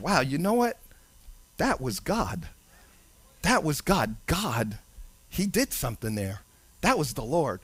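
low, soft, reverent realization; hushed awe. Pretend. Believer looking back on life, quietly awed. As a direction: let it sink in quietly.

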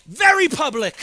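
VERY public, punched loud; loud emphatic preaching. Real, not acted. Preacher to congregation, loud emphatic stress. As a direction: hit very hard and let it ring.

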